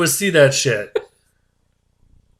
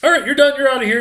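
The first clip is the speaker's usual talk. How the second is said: high, breezy dismissal; brisk staff voice; 10 semitones higher, faster than usual. Retold or imitated. Staff sending the patient off, high and breezy. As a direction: wave the patient out cheerfully. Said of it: "they're like".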